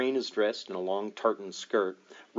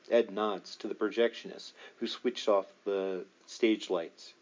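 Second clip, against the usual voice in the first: ordinary delivery, nothing marked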